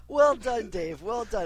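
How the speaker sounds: sarcastic slow clap. Pretend. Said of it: "I'm gonna give you a slow clap".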